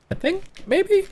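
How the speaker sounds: tentative, very high-pitched aside; high unsure squeak. Real, not acted. Unsure of a viewer's name.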